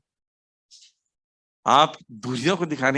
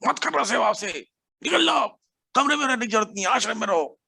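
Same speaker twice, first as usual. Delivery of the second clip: higher, mock-stern telling off; imitated scolding; 9 semitones higher than usual. Guru scolding a disciple over his service, a higher imitated scolding. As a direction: act out the guru's sharp rebuke, not full force. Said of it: "डांटा किसी की सेवा के लिए, खूब जबरदस्त".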